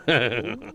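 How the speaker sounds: a short knowing chuckle after the goodbye; sly chuckle. Acted. Gentleman leaving the doctor, sly chuckle. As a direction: chuckle to yourself as you leave. Sleazy gentleman ends with a laugh.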